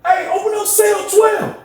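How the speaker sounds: very high, drawn-out call; mock high-pitched officer; imitated guard calling out.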